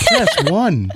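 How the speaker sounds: automated phone menu voice. Retold or imitated. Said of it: "customer service voice".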